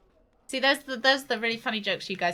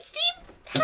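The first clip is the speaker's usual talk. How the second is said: excited. Real, not acted. High-pitched call to donor.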